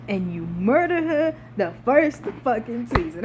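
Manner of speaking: ordinary talk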